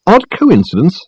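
high, scornful echoed question; snorting disbelief. A narrator's reading. Dirk scoffing at Richard's word coincidence, snorting. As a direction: throw ODD COINCIDENCE back, high and incredulous. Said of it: "snorted Dirk".

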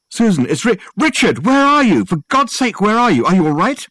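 high, loud, urgent questions; desperate to reach her; panicked. A narrator's reading. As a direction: Richard calls Susan in a panic, high and loud. Panicked call to Susan.

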